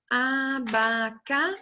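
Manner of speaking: abacaxi drawn out syllable by syllable, landing on XI; stress on final syllable. Shown as a demonstration. Teacher showing children the stressed syllable, abacaxi slowly syllable by syllable. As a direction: sound out a-ba-ca-XI slowly, weight on the last syllable. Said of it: "é a sílaba que tem mais força".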